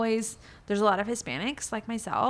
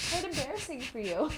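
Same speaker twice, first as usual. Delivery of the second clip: high, soft mock-scolding; teasing the bird; 5 semitones higher, 7 dB quieter than usual. Real, not acted. Telling her noisy bird off, high soft teasing. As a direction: gently tease a pet bird.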